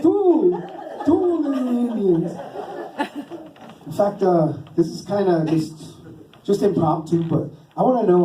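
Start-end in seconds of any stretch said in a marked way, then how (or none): 0.0-2.3 hammed-up, stretched-vowel counting. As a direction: call out the tally with theatrical delight. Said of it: "'cause he'd be like".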